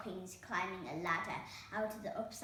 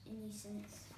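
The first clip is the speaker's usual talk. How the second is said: plain reading voice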